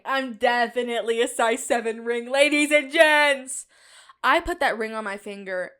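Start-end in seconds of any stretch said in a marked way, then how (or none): 0.0-3.7 cocky showman. Retold or imitated. Her confident mindset announced to the room, hammed up. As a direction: proclaim it like a ringmaster. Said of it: "this was my mindset".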